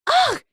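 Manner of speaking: a sudden high, loud groan of frustration; exasperated shout. A narrator's reading. Sister fed up with the boys' burping, exasperated shout. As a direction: burst out with a loud, fed-up "ugh". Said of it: "Katara shouted".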